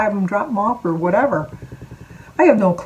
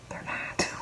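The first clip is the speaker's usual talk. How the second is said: whispering; 14 dB quieter than usual. Real, not acted. Aside, unvoiced with laugh.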